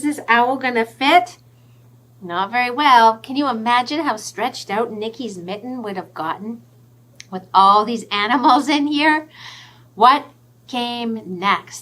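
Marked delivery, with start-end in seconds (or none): none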